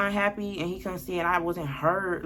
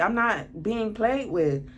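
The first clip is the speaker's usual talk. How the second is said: ordinary talk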